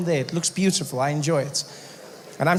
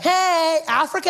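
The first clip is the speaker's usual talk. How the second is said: put-on high, slow airy California girl; valley girl voice; 11 semitones higher, slower than usual. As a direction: play the ditzy Malibu surfer girl.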